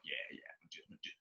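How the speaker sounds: breathy aside under the breath; whispered. Retold or imitated. Whispering to himself about the audition. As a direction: whisper it off casually.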